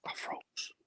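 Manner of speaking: breathless unvoiced whisper of shock; frozen whisper. Agent recalls freezing when confronted by club, hushed whisper. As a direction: whisper it as if the shock hits you again.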